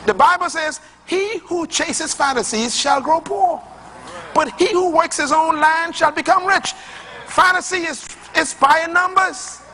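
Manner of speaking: high, ringing pulpit voice; climbing sermon cadence, driving each phrase; full-force preaching. Acted. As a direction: preach it hard to the congregation. Pastor preaching on gambling.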